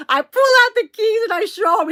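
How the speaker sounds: high, loud, tickled squeal; laughing while talking. Real, not acted. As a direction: tell it through a burst of laughter. Laughing climax of key story.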